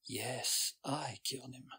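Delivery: hushed, private admission; inward thought. A narrator's reading. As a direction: think it aloud very softly. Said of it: "Rayson thought".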